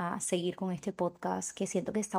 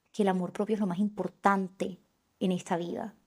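ordinary talk, nothing marked

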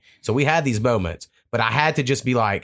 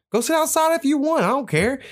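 much higher, dismissive brush-off; dismissive retort. Retold or imitated. Son brushing off dad's invite, high mocking dismissive. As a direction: wave him off, exaggerated. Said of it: "And so I said".

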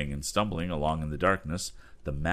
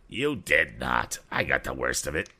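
indignant cry; 3 semitones lower than usual. Acted. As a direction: insist you got it worst. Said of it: "cried Andy".

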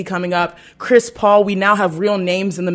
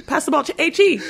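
high playful call across court; 8 semitones higher than usual. Retold or imitated. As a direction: shout her nickname teasingly.

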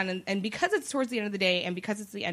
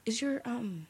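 tentative question; 7 dB quieter than usual. Retold or imitated. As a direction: imitate an awkward fan fumbling his question. Awkward questioner pressing on, retold.

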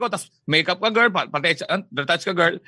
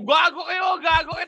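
laughing protest; 9 semitones higher than usual. Retold or imitated. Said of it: "gaganon na yung si Binibiro".

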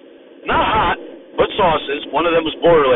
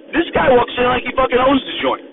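normal talking voice

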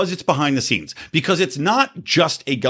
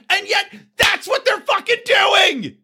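riled-up shout; slower than usual. Real, not acted. Host ranting to viewers about the climate cabal, real furious shout. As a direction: explode with real outrage, slowing down. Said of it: "I'm getting riled up here".

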